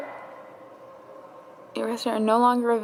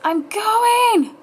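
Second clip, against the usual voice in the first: much higher, louder joyful cry; elated cheer; 10 semitones higher, 7 dB louder, much slower than usual. Real, not acted. Vlogger celebrating getting into Seawees, elated. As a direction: shout the win out.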